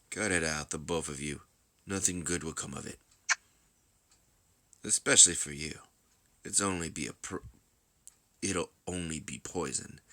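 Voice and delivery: subdued, knowing caution; cool mediator. Acted. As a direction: warn them quietly, letting lines drop.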